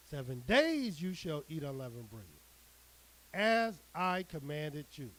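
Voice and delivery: measured, declaiming scripture read; solemn preacher reading the Word. Acted. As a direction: proclaim the verse to the flock. Reads scripture aloud to congregation.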